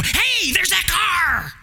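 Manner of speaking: high, delighted "hey, there's that car"; excited exclamation. Retold or imitated. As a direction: spot it on the road and light up. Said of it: "you go".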